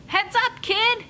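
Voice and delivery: high, loud yell of warning; shouted warning. A narrator's reading. Beach player warning Gideon of a ball, shouted high. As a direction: call it across the beach. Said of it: "someone shouted".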